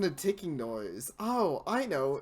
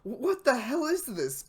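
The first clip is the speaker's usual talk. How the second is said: surprised; 7 semitones higher, 6 dB louder than usual. Real, not acted. Reacting to game twist.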